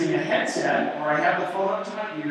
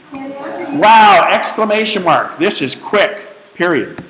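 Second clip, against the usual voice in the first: louder, punctuation said aloud; dictation voice; 2 semitones higher, 8 dB louder, slower than usual. Shown as a demonstration. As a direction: dictate quickly, naming the marks. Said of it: "Let's watch how quick that is".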